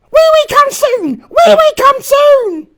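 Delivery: put-on very high, loud, slow wailing toddler; crying little girl. A narrator's reading. Little girl in the other corridor wailing for the toilet, high and loud. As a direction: wail it like a desperate small child. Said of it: "the little girl crying".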